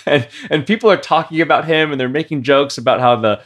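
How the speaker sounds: high, loud, giggly telling; amused, laughter breaking in between phrases; laughing while telling. Real, not acted. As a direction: tell it through bursts of laughter.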